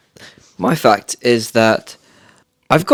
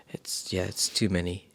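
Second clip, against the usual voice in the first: fading whisper; 2 semitones lower, 14 dB quieter than usual. Real, not acted. Trailing off about hats.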